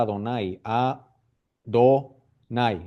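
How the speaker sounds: "A, Do, Nai" in separate syllables. Shown as a demonstration. Teacher showing the vowels of Adonai, syllable by syllable. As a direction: say "Adonai", then split it: A... Do... Nai.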